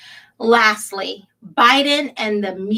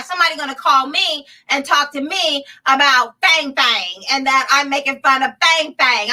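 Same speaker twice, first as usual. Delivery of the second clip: usual speaking voice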